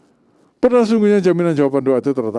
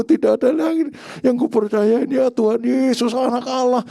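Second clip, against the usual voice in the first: high-pitched, overwrought pleading prayer; wordy show prayer; 11 semitones higher, 4 dB louder than usual. Acted. Preacher mimics a long-winded prayer to God for healing, overwrought. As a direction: pile on devout words, pitched high and building louder. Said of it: "Itu disusun menjadi empat puluh, lima puluh kata".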